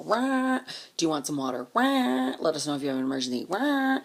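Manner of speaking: mock-cheery drive-by shouting; mocking Red Cross. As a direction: ham up a passing megaphone call.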